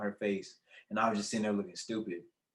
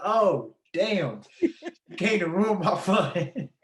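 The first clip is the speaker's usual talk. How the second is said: higher, slow whiny complaint; mock whining; 8 semitones higher, 4 dB louder, much slower than usual. Student caught by his mom, whining she ruined his fun. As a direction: drag it out like a busted kid.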